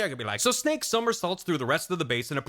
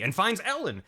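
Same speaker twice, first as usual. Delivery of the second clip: ordinary talk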